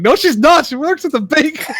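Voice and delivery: high laughing protest. Retold or imitated. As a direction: squeal the childish denial while cracking up. Kid defending mom, laughing.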